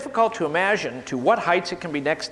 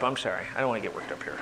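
much softer and lower, apologetic; calming down; 10 semitones lower, 8 dB quieter, much faster than usual. Real, not acted. Speaker apologizes to audience for getting heated, hushed and low. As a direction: pull right back, settle down.

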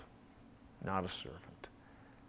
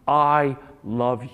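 loud and slow; fervent declaration; 3 semitones higher, 11 dB louder than usual. Acted. Father telling his son his love, fervent and loud. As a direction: pour it out to the son. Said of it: "and says".

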